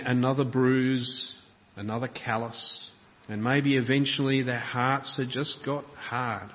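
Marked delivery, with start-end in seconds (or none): none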